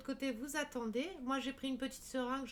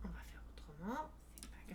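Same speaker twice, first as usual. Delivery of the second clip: whisper; 5 semitones lower, 7 dB quieter than usual. Real, not acted. Gives up on the stuck marker.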